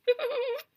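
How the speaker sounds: high squealing burst of laughter; shrieking laugh. A narrator's reading. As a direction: explode into helpless giggles. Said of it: "Delphi goes bonkers".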